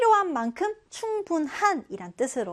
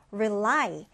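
"rely" with the stress on the final -LY; stress on second syllable; 3 semitones lower than usual. Shown as a demonstration. Teacher models the new word for learners, "rely" stressed at the end. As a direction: lean on the back syllable of "rely". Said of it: "이 단어 디귿절에 강세를 두고요".

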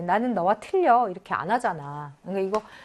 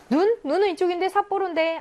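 surprised; 10 semitones higher, 3 dB louder than usual. Real, not acted. Teacher spots wrong city in question.

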